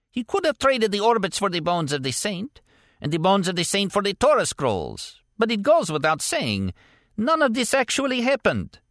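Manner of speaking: a grinning warlord toying with his prisoner; teasing warlord. A narrator's reading. Warlord grins and teases.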